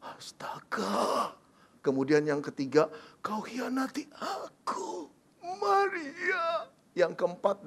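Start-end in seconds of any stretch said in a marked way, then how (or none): 0.0-1.3 slow breathless whispered mutter; stunned whisper. Acted. Joseph mutters in shocked disbelief to himself. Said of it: "dia bergumam". 3.2-6.7 crying, voice rising on Maria; tearful betrayal. Acted. Betrayed Joseph cries at Mary.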